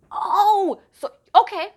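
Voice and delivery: high, loud overwhelmed "oh"; emotional surprise. Real, not acted. Tarot reader moved by the card's message. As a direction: caught off guard by a feeling.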